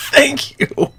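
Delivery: shrill, high, loud squeal of relief; overjoyed high squeal of thanks; ecstatic squeal. Real, not acted. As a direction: shriek a thank-you like a rescued fan. Overjoyed at skipping Natsuki.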